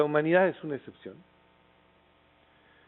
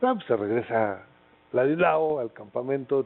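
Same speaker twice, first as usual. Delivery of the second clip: normal talking voice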